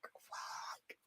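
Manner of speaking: unvoiced hushed swearing; retold whisper of dismay; whispered curse. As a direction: breathe the curses out with no voice. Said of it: "I was like".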